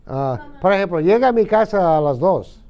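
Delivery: ordinary talk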